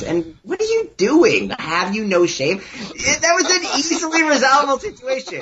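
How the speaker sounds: lightly hammed-up indignation, starting high; mock-dramatic reproach. Friend imitating the subway confrontation, mock-dramatic reproach. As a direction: play a stagy reproach, mildly overblown. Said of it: "who speaks like that?".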